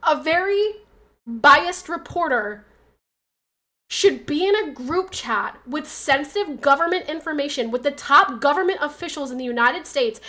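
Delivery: ordinary talk, nothing marked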